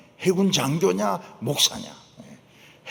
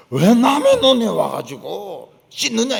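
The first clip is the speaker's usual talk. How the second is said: 따지는 호통; 5 semitones higher, 7 dB louder, slower than usual. Retold or imitated. 논에서 다투는 장면.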